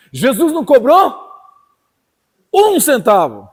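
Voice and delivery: rising, forceful pulpit voice; impassioned preacher to the congregation; fiery preaching. Acted. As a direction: build the sermon up and drive it home.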